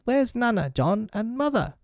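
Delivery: put-on very high little-boy plea; crying out. A narrator's reading. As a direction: call your brother awake in a small child's voice. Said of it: "Michael would cry".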